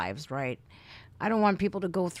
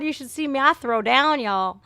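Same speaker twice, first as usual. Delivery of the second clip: normal talking voice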